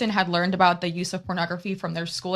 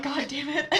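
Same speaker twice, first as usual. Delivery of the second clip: laughing through tears; 8 semitones higher, 6 dB quieter than usual. Real, not acted. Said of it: "Now I'm crying".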